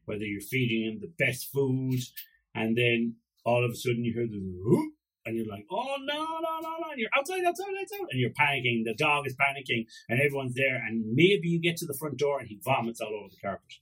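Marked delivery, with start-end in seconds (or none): 5.7-8.1 high-pitched frantic pleading; urgent, high-pitched alarm, acted out; mock panic. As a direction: blurt it out in a hammed-up panic. Panicking as dog retches.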